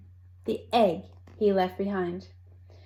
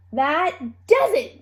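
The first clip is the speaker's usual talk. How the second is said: shouted; 5 semitones higher, 5 dB louder than usual. A narrator's reading. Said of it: "Dot shouted".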